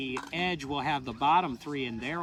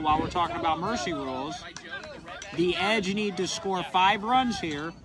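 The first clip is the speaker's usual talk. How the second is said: play-by-play; 6 semitones higher, 5 dB louder than usual. Acted. Live play-by-play of inning's end.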